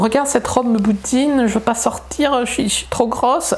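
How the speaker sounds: hammed-up high sulky whine; airhead voice. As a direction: drag it out like a girl sulking in front of the mirror. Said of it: "je fais des voix de conne".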